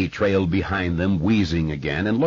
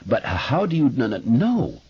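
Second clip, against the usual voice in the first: honest stuttering question; 6 semitones higher than usual. A narrator's reading. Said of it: "not sarcastic disbelief, but honest interest".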